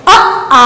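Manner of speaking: अ clipped short, then आ held long; quick अ, drawn-out आ; short then long vowel. Shown as a demonstration. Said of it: "आपको कैसे पढ़ना है इसको". (छोटा अ, लंबा आ)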